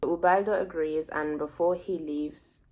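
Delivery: usual speaking voice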